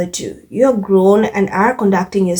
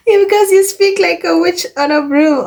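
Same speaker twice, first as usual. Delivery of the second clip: much higher, giggling through the words; laughing repeat; 10 semitones higher than usual. Real, not acted. Vlogger repeating a comment she finds hilarious, laughing. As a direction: crack up as you repeat it.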